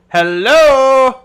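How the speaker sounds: high loud hello from the other room; calling hello. Acted. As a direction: call out to someone you can't see. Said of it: "hear Sirik go".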